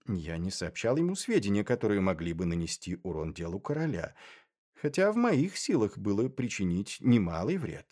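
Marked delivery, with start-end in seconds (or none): none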